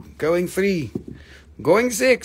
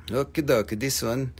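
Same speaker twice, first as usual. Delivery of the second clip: ordinary delivery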